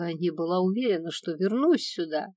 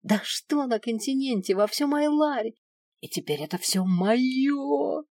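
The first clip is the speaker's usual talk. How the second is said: gloating dragon; 5 semitones higher, 3 dB louder than usual. Acted. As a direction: claim it all with rising, fanatical greed.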